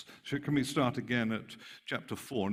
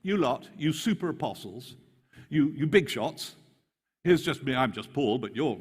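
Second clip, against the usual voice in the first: higher, sneering mock praise; mocking sarcasm; 5 semitones higher, 5 dB louder than usual. Paul taunting the super apostles, higher mock praise. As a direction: puff them up to knock them down. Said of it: "He's mocking them".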